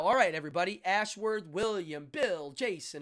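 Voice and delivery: ordinary delivery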